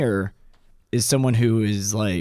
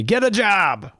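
mocking; 9 semitones higher, slower than usual. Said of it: "most people say".

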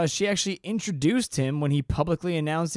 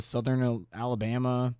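ordinary talk

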